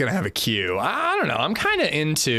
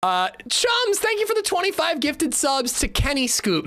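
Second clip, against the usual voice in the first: high excited voice; 12 semitones higher than usual. Acted. Thanking donors in high voice.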